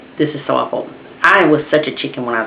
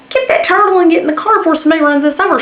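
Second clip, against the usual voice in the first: impatient urging; 12 semitones higher, faster than usual. Retold or imitated. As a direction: hurry her up, fed up.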